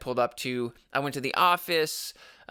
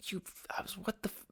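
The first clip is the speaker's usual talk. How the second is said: stunned whisper; 8 semitones higher, 13 dB quieter, faster than usual. Retold or imitated. Stunned by manager's rules.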